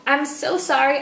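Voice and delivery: ordinary talk, nothing marked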